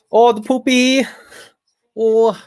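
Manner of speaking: high squeaky mock Indian accent. Mocking Indian stereotype after India joke.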